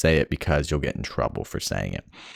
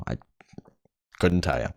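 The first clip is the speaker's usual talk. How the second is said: ordinary delivery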